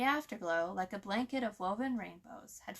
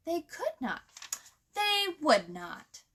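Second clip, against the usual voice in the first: Miss Hickory; 8 semitones higher, 5 dB louder than usual. A narrator's reading. Miss Hickory refusing, upset.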